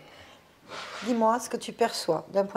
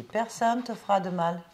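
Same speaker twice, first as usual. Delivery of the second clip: normal talking voice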